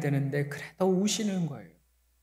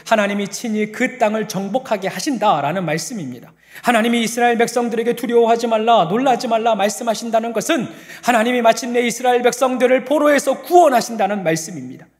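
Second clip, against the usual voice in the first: higher, louder and fast; fast fervent preaching; 9 semitones higher, 9 dB louder than usual. Real, not acted. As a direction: preach with rising fervour. (빠르고 힘차게 외치는 설교)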